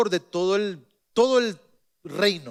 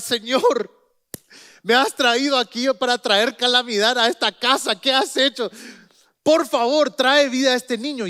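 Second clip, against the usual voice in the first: louder, higher pleading prayer; weeping plea; 5 semitones higher, 6 dB louder than usual. Acted. The prophet begs God to revive the boy, loudly pleading. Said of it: "Elías empieza a llorar".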